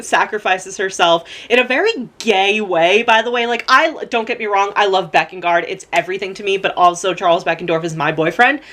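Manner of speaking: ordinary talk, nothing marked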